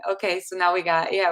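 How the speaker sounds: ordinary delivery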